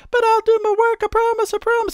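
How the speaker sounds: loud, squeaky-high falsetto promise; mock pleading coworker. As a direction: beg in a silly high falsetto. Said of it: "you're gonna be like".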